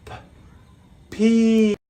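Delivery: usual speaking voice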